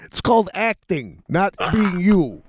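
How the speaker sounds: high-pitched comic director's explanation; Seinfeld. Retold or imitated. Director telling his actor it's acting, high-voiced impersonation. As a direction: draw out the end, pitched well up, mock-patient. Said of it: "And Seinfeld goes".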